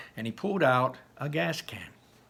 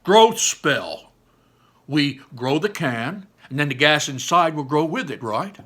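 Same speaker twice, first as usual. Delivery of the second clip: excited; 4 semitones higher, 8 dB louder than usual. Acted. Barley pitching the spell.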